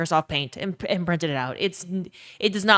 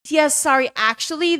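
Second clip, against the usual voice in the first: lightly voiced apologetic clerk, pitched up; higher-pitched mock customer-service apology; DMV clerk; 8 semitones higher, 3 dB louder than usual. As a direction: imitate an official politely refusing, higher voice. Voices DMV clerk turning her away.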